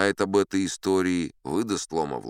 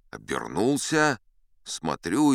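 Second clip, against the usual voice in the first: ordinary delivery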